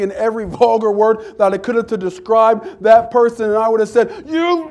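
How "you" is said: high-pitched, garbled mock tirade; mock cussing out. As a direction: play at chewing someone out in gibberish. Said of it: "chewed them out and used every".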